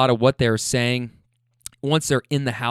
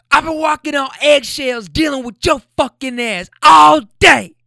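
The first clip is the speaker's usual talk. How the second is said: high, loud scream imitating the woman; top of lungs; 12 semitones higher, 8 dB louder than usual. Woman fed up with walking on eggshells, imitated top-of-lungs scream. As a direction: build to a huge mock scream at the end. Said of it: "at the top of her lungs".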